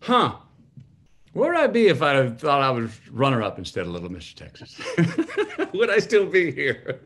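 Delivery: amused musing through laughter; laughing. Retold or imitated. Son musing on the runner-up trophy, laughing. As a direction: laugh as you wonder aloud. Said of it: "I remember laughing".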